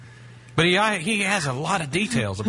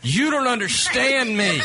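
high, slow, pleading whine; whiny girlfriend; 9 semitones higher, slower than usual. Retold or imitated. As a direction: play the upset girlfriend on the phone. Acted quarrel as the girlfriend.

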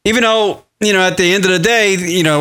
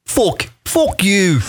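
"Fuck you" with "fuck" said like "fook", Irish vowel; mock Irish "fook you", half-hearted; 4 semitones higher, much slower than usual. Shown as a demonstration. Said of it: "First, you gotta say "fuck".

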